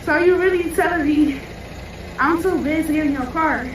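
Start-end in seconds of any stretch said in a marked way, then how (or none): none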